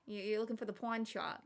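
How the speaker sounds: looking for the pawn shop with pawn as pwine, thick East Coast; a light East Coast mom accent; imitating mom's East Coast accent. Shown as a demonstration. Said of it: "thick East Coast accent".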